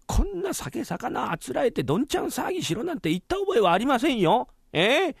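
high-pitched, outraged rebuke; indignant scolding. Acted. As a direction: scold the head clerk, voice climbing high. Master rebukes servants for the party.

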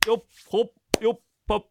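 high, short stroke calls; rhythmic work calls. Pretend. Husband finishing fake pounding on wife, work calls. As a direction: call each stroke sharply.

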